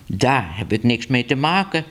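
plain reading voice

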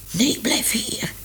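a slow whispered plea; urgent whisper. Acted. Stray cat whispering to Minoes to stay. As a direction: whisper to keep her from going.

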